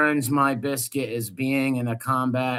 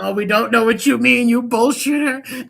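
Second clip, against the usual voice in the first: mocking retort; 9 semitones higher, 7 dB louder than usual. Real, not acted. Mocking a guest's vague claim.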